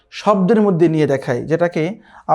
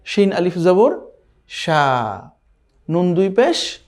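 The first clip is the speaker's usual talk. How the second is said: slow spelling; much slower than usual. Shown as a demonstration. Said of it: "তাহলে কি হবে?".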